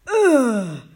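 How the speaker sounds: loud, falling "ugh"; disgusted groan. A narrator's reading. Visitor shown mouse poo on the carpet, loud disgusted groan. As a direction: let out a big revolted groan.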